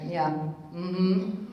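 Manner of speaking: ordinary delivery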